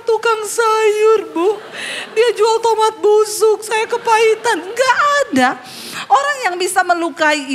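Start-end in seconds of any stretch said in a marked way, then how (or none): 0.0-5.5 mock wailing. Mock tearful woman then denial.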